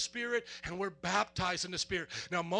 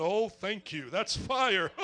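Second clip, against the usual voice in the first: mock calm; 2 semitones lower, 4 dB louder, slower than usual. Mocks calm reaction to touching fire.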